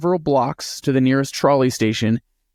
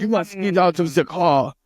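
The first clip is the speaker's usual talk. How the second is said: put-on heavy German accent, slightly higher; 4 semitones higher than usual. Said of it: "is with a heavy German accent".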